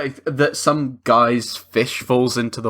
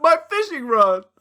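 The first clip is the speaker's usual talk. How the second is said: high hammed-up wail; comic high-pitched lament; mock distressed cry; 11 semitones higher than usual. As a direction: cry out over lost gear in mock anguish. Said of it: "and he's like".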